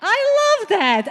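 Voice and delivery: high, loud, delighted; slow gleeful cheer; excited. Real, not acted. Host cheering an audience tip.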